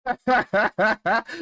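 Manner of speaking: high, quick run of laughter; laughing. Real, not acted. Host reacting to the one-sided argument, laughing. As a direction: burst out laughing.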